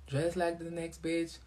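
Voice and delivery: ordinary delivery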